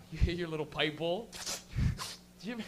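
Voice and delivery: ordinary talk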